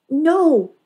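high, loud No; shouted no. A narrator's reading. Bandit stops her cousin with a shouted No. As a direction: cry out No to stop her. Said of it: "shouted Bandit".

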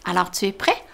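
ordinary delivery, nothing marked

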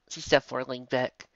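ordinary delivery

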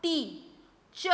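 टी, then च, with a clear pause between; isolated syllables टी and च, each on its own; one syllable at a time. Shown as a demonstration. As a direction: break the word into "tee" ... "chuh". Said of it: "एक syllable में आपने क्या बोला".

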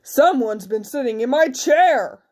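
big, emphatic bear voice; big bear's indignant grumble. A narrator's reading. Big bear finds chair used, indignant big voice.

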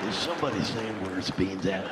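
cowboy in the audience asking who said it. Retold or imitated. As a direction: drop low like a gruff cowboy. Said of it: "some guy with a cowboy hat".

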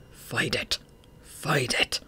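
low, hushed urging to himself; urgent self-urging. A narrator's reading. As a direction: push himself on under his breath. Urging himself not to pass out.

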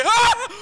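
high loud yelp of fright; startled scream. Real, not acted. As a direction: shriek at the scare. Another jump scare hits.

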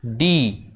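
ডি with a long, falling "ee" ending; drawn-out ডি. Shown as a demonstration. Teacher showing pupils the vowel in the letter, ডি drawn out. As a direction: hold the vowel at the end of ডি. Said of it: "ই উচ্চারণ হচ্ছে".

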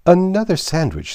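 high, loud demand. A narrator's reading. King demands food.

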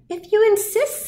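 very high little insect voice; high-pitched storybook wasp; yellow jacket. A narrator's reading. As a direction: pipe it up high, obligingly. Yellow jacket answers the challenge.

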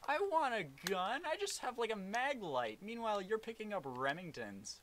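high, loud whiny protest; whiny complaint. Real, not acted. Complaining about having no gun.

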